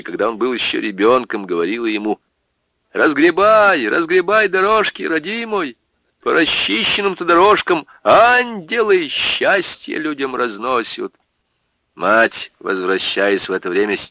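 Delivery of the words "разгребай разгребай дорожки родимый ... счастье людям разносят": put-on higher, tender old woman voice; grandmother voice. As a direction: coax a little child gently. Grandmother's words to child.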